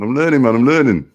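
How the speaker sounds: loud, high playful protest; half-laughing protest to the chat; mock protest. Real, not acted. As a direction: cry out in cheeky self-defence. Defending himself to chat, laughing.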